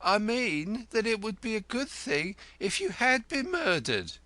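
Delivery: high, biting wish; wife telling the lodger he should be dead; 1 venomous. A narrator's reading. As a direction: say it with relish and spite. Wife wishes him dead.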